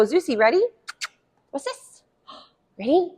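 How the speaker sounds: high, breathy playful cue; excited puppy talk. Shown as a demonstration. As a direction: hype the puppy up with a gasp.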